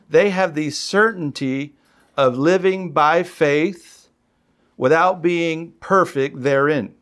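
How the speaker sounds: slow, deliberate reading aloud; emphatic quote reading. Real, not acted. Preacher reading a commentary to the congregation, slow and emphatic. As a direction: read it out slowly, phrase by phrase.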